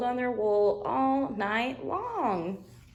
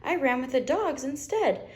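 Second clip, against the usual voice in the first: higher, bouncy little lamb voice; high chirpy Wilbur; cheerful young lamb; 8 semitones higher than usual. Acted.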